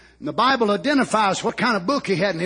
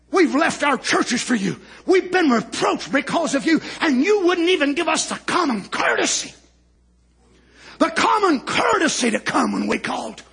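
retold reproach, pitched up; upbraiding; 8 semitones higher than usual. Preacher voicing Martha's complaint to Jesus, mock upbraiding. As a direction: scold as the aggrieved sister, in a preacher's retelling. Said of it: "she had a right to upbraid him".

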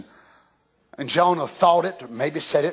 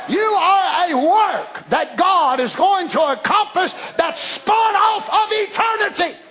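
full-force preaching; 10 semitones higher than usual. Acted. Preacher shouts to the church about the gene of God. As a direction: preach it out to the congregation.

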